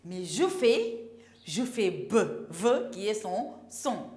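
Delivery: normal talking voice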